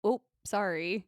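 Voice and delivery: drawn-out Canadian "sorry"; Canadian-sounding accent. Retold or imitated. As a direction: imitate a slow Canadian apology. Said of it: "a little bit Canadian".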